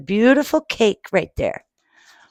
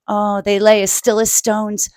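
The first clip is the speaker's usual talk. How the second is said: plain reading voice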